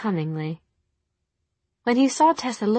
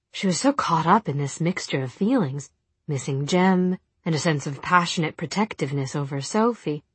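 usual speaking voice

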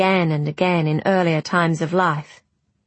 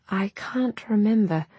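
put-on frail old woman; 4 semitones higher than usual. Confused dying grandmother speaks.

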